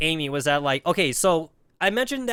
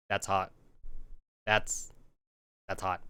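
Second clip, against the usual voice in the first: much softer, lower, stunned hush; mock awed murmur; 10 semitones lower, 12 dB quieter than usual. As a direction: breathe it out, dumbstruck. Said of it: "I'm all like".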